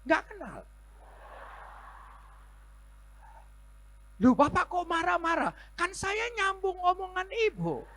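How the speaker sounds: very high, loud and insistent; heated retort. Retold or imitated. Pastor answering the surprised woman, very high and loud. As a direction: fire it back at her. Pastor explains his anger.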